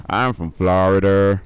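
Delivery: Florida accent. Shown as a demonstration. Streamer showing off his new skill, loud Florida accent. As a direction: lean hard into a Florida accent. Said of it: "now I can do a Florida accent".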